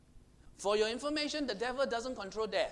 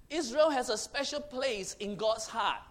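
ordinary delivery

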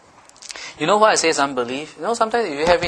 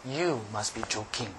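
quiet disbelief; 5 semitones lower, 13 dB quieter than usual. Retold or imitated.

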